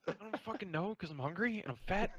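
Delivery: exasperated. Real, not acted. Defensive joke after being teased.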